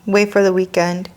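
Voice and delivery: usual speaking voice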